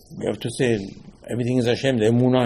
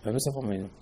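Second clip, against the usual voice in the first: softer, murmured repeat of the blessing; quiet echo; 2 semitones lower, 10 dB quieter, much faster than usual. Retold or imitated. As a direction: echo it back under your breath. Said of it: "I said".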